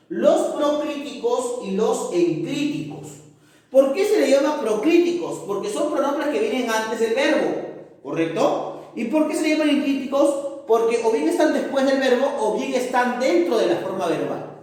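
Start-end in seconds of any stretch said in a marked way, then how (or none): 3.7-5.4 higher, louder pupil voice; student question. Acted. Pupil asking why they are called proclíticos, higher. 8.9-10.5 higher, fast pupil voice; student question. Acted. Pupil asking about enclíticos, higher and fast.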